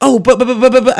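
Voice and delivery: high, loud spluttering buts; hammed-up manager caught out; flustered stammer. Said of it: "flustered manager stammers".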